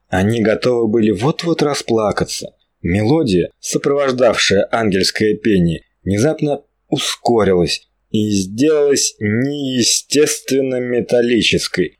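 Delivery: plain reading voice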